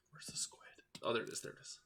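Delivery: hushed whisper. Real, not acted. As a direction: whisper so as not to scare it. Searching for the squid.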